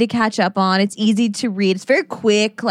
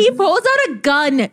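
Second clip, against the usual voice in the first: laughing disbelief; 5 semitones higher than usual. Real, not acted. Shocked retelling of gun scene.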